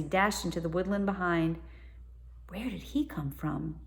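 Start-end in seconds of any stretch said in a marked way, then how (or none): 2.5-3.8 softly whispered. A narrator's reading. Tom whispers to Stella after the boy runs, soft whisper. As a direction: hush it, wary of being heard. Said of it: "whispered Tom".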